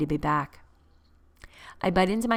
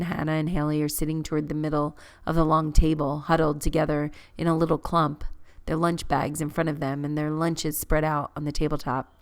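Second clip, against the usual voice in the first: usual speaking voice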